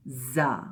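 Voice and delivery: زا with straight closed teeth, buzzing z; letter sound demo. Shown as a demonstration. Teacher models زا, teeth pressed together. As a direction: buzz زا through the teeth. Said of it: "دانتوں کو جو ہے آپس میں ملی".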